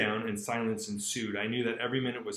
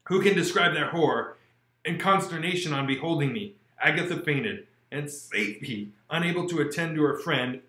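measured, expressive audiobook narration; steady storyteller voice carrying the chapter; story reading; 10 dB louder than usual. A narrator's reading. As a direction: read the classic aloud with feeling. Reads Frankenstein aloud.

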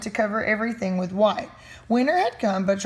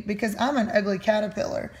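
usual speaking voice

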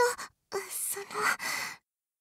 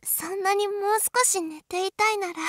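normal talking voice

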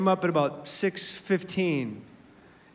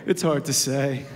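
much lower, beaten-down mumble; defeated kid mumble; 7 semitones lower than usual. Retold or imitated. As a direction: say it like the day crushed you. Son's defeated reply.

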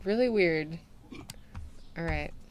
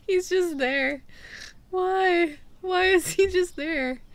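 baffled; 9 semitones higher, slower than usual. Real, not acted. Baffled by still customer.

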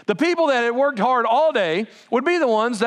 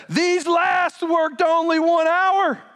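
higher, slow indignant complaint; indignant workers; 9 semitones higher, slower than usual. Retold or imitated. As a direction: whine it out slowly like a wronged worker. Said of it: "this is what they said".

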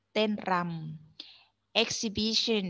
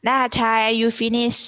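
calling out; 4 semitones higher, 10 dB louder, much slower than usual. Real, not acted. Teacher calls out to a student.

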